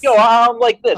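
loud bad Brooklyn tough guy; Brooklyn accent. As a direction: belt out a hammy Brooklyn accent. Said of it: "the worst Brooklyn accent anyone's ever heard".

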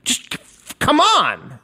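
high, loud impatient groan of come on; exasperated come on. Retold or imitated. Fed up with the slow internet, loud high come on. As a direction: urge the slow world to hurry up. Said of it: "everything is just like".

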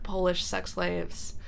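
ordinary delivery

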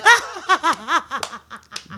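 shrill laugh burst; high squealing laugh. Real, not acted.

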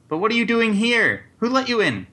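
high-pitched, flustered demand; startled questioning. Acted. Banker asks unexpected visitors who let them in, startled. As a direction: question the intruders, pitch jumping up.

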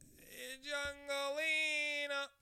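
very high, loud, long-held call; mock jungle call. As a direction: belt it out high and hold the vowel. Repeats the mock jungle call, bigger.

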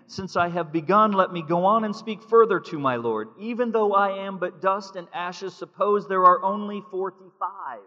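loud preaching read; preacher reading Abraham's plea aloud; forceful scripture reading. Acted. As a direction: proclaim the text with full force.

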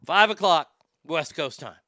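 ordinary talk, nothing marked

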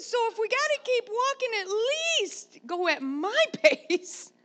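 high, loud demand to a son hurrying ahead; loud insisting. Retold or imitated. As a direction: insist at full voice, huffing at the end. Demanding son slow down, winded.

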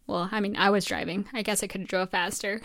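ordinary talk

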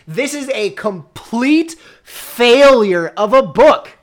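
loud, slow, high exasperation; exasperated rant. Real, not acted. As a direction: rant at the ruined climax. Exasperated rant about the climax.

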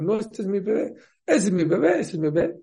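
high, insistent back-and-forth claims; mock quarrel. Two mothers fighting over one baby, insistent. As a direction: imitate two mothers bickering, voice jumping up.

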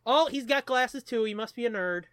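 normal talking voice

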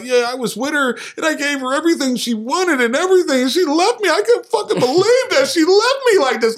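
high, whiny mock of a dumped man; whiny heartbroken man. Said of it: "if you just talking about, like".

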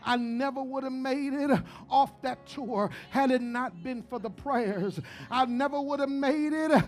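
loud rising preacher's call, chanting the lines; full-force preaching. Acted. Pastor preaching to his congregation, building loud. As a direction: preach it hard over the organ.

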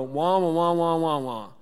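loud muffled trombone-like wah-wah drone; Charlie Brown adult. Retold or imitated. Charlie Brown adults droning at the kids, loud muffled wah-wah. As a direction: honk it like a muted trumpet adult. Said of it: "that's how they sound".

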